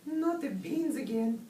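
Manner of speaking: slow, high-pitched kitten whine; complaining kitten. A narrator's reading. Skipito hears beans are coming, slow high complaint. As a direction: draw out the complaint high and squeaky.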